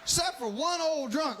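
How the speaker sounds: normal talking voice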